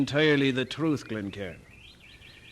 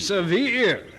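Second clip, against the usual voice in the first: higher, louder outraged question; indignant echo; 6 semitones higher, 4 dB louder than usual. Acted. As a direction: throw the word back in disbelief.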